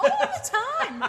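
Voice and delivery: very high, slow, loud mock catchphrase; mimicking Randy. Host teasing partner with his catchphrase, high and slow. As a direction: stretch it out high like a parrot. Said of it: "there's a bit where he goes".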